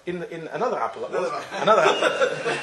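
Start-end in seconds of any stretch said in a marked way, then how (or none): 1.6-2.6 laughing. Real, not acted. Laughing at his own story.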